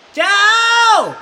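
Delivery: very high, loud call. A narrator's reading. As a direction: call far across the ridge. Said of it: "the boy called".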